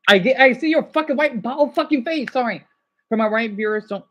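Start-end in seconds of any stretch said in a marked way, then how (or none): 0.1-2.3 heated, higher and louder insult; angry outburst. Real, not acted. Streamer lashing out at a viewer, angry. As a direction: lash out with a raised voice.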